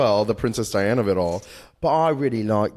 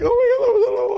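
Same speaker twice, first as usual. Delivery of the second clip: much higher, louder delighted shriek; delighted squeal; 4 dB louder, much faster than usual. Retold or imitated. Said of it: "everyone was like".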